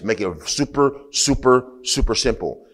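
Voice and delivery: each super stretched and lifted; emphatic repeated super; super super simple. Real, not acted. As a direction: build the supers upward. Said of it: "just simplify it like this".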